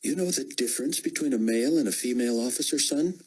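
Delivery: snapping at him. A narrator's reading. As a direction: rebuke the soldier quickly and sharply. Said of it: "Chloe snapped".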